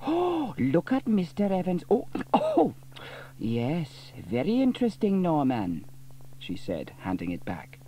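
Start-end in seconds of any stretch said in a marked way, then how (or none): 0.1-5.8 nosy gossip =93. A narrator's reading. Dilys spying excitedly.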